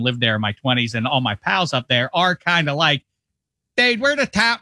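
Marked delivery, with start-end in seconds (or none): 3.8-4.6 high, hammed-up Pittsburgh accent. Chicago pals ribbing Dave, in a mock Pittsburgh accent. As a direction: play a Pittsburgh buddy in a Pittsburgh accent. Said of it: "I'm doing a Pittsburgh accent".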